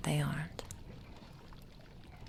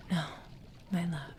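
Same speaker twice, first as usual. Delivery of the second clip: normal talking voice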